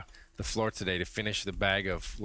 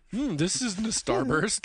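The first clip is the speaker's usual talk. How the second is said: slow, higher, mock-puzzled musing; mock suspicious taster; 9 semitones higher, slower than usual. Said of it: "you were like".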